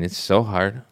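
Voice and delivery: ordinary delivery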